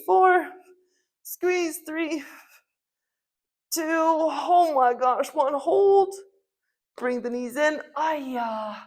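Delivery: straining mid crunch, counting; counting through burning abs; doing sit-ups. Real, not acted. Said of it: "My abs are burning".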